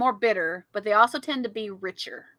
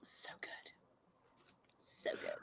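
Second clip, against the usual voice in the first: whispered craving; 26 dB quieter than usual. Real, not acted. Dreamy craving for chocolate.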